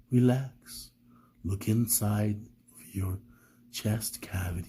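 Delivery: imitating meditation guide. Mimicking a YouTube meditation narrator for viewers, hushed and slow. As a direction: lead them to relax, soft and slow. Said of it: "they'll say".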